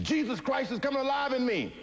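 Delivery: very high, strained proclamation; ecstatic scream. Retold or imitated. Fighter in the dressing room screaming his vision, ecstatic. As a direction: scream out the revelation. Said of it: "And I started screaming".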